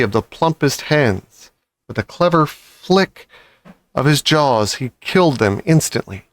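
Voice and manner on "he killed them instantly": plain reading voice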